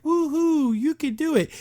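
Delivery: a high, limp cheer with no heart in it; phoned-in cheer. Retold or imitated. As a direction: imitate a bored, unenthusiastic cheer. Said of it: "that line is so phoned in".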